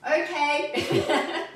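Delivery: loud, high laughing okay; speaking up. Real, not acted. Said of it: "you might need to speak up a little bit".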